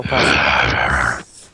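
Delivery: deep seductive voice. Pretend. Asked to read seductively.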